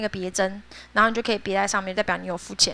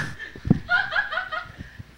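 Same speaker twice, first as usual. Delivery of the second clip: shrieking laugh; 8 dB quieter than usual. Real, not acted. Laughing at own mockery.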